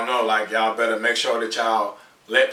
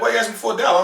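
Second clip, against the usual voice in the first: higher, puzzled asking tone; high suspicious question; 7 semitones higher, much faster than usual. Retold or imitated. Stranger asking why he needs a dollar, high and puzzled. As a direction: ask it up high, confused.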